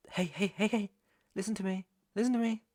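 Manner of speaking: high, insistent plea for attention; urgent calming. Acted. Trying to get crying captive's attention.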